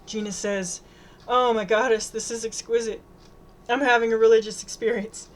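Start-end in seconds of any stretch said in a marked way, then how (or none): 1.3-5.3 louder gushing delight; ecstatic, emphatic praise; rapturous. A narrator's reading. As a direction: gush over something delicious. Gina raving about exquisite cake.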